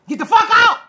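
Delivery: high, loud "get out" yell; voicing the Russians throwing her out, a loud yell; mock eviction yell. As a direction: bark them out of the country.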